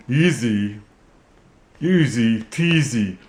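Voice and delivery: ventriloquist dummy voice; puppet voice. Acted. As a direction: speak as the puppet. Puppet quips.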